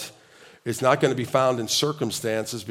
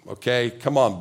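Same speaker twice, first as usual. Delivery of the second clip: ordinary delivery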